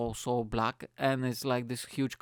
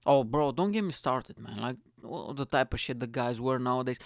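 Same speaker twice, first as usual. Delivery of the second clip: ordinary talk, nothing marked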